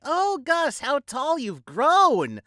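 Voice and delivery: high, slow, syrupy society lady; mock society lady gushing. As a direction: coo it like a doting matron. Gushing party lady voiced.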